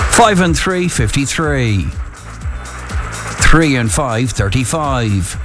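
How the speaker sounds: drawn-out bingo calls with pauses; bingo caller. Acted. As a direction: call each number like a bingo hall. Bingo caller calling numbers.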